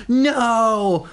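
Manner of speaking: high, startled yelp of dismay; horrified cry. Acted. Reading Willa discovering her wrecked room, horrified cry. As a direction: gasp it out like a horrified girl. Reader acts Willa's horror.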